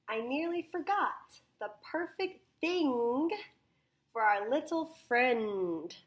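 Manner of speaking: slow, high, loud reading with "thing" stretched; big storybook voice, "thing" drawn out; emphasized read-aloud. Shown as a demonstration. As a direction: read to children, hitting "thing" long and loud. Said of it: "emphasize the word".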